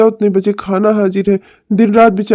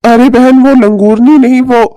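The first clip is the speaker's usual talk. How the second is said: louder, higher, in a different voice; exasperated protest; 4 semitones higher, 6 dB louder than usual. Acted. Uncle loudly corrects his sister, exasperated. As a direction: throw up your hands and correct her.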